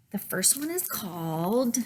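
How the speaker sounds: hushed whisper. Real, not acted.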